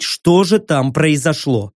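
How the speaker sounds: ordinary delivery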